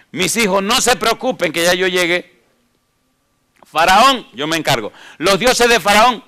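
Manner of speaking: loud, rising preacher's voice; fiery preaching. Acted. Preacher pressing the congregation, at full force.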